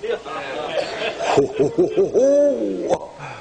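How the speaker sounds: long mock wolf howl; drawn-out hooting howl, like a wolf; imitated wolf howl. Preacher shows the congregation the wolf, mock howling. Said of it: "That's a wolf".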